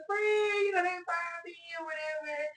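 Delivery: crying out to her best friend for help; upset high-pitched plea, drawn out; distressed wail. Retold or imitated. Retelling call to friend.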